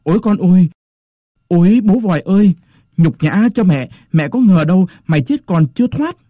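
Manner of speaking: put-on high wailing cry of an old mother; grieving mother wailing at the mandarin; wailing lament. A narrator's reading. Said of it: "òa lên khóc".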